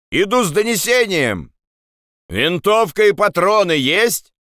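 high-pitched call across to the other side; shouted challenge and reply, pitched high. Acted. Shouted exchange with guards across rampart. (перекличка криком)

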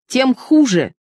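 high-pitched angry outburst; exasperated narrated rage; furious. A narrator's reading. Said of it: "Он снова пришёл в ярость".

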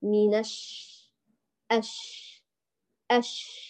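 long breath-filled sh ending الش; held, flowing shhh in أش; spreading airy shin. Shown as a demonstration. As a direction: let the air run out through ش in أش. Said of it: "وخلي الريح ينتشر".

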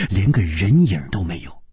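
ordinary delivery, nothing marked

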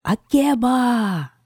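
long high shout up into the tree; calling out. Acted. Queen calling the witch from under the pear tree, long high shout. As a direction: call her name up into the branches. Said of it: "крикнула".

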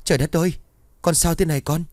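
mother's panicked outcry, pitched high; panicked cry. A narrator's reading. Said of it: "hoảng hốt hét lớn".

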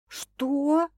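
high astonished question; amazed. A narrator's reading. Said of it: "изумлённо уточнила девушка".

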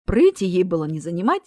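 usual speaking voice

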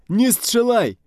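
very high, loud panicked wail; terrified shriek with a Polish accent; frightened scream. Acted. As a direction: cry out for your life. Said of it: "пронёсся испуганный вопль".

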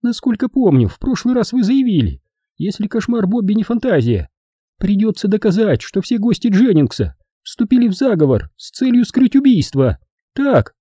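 high falsetto, coldly argumentative; high-pitched, haughty cross-examination; icy woman challenger. Acted. Voices Edvina challenging the detective.

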